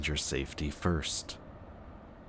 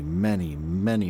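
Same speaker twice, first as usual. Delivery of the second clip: each many punched louder; emphatic repetition; 6 dB louder than usual. Acted. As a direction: lean hard on every many. Listing the many cons.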